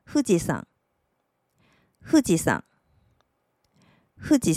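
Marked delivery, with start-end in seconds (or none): 0.0-0.7 富士山 read as Japanese "Fujisan". Shown as a demonstration. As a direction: say 富士山 as "fu-ji-san". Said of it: "日文念成".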